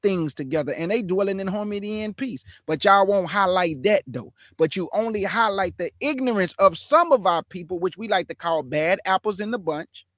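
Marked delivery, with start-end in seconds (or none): none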